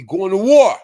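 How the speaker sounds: threatening. Real, not acted. Host warns an opponent off the lie, loudly threatening. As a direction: hit it hard as a threat.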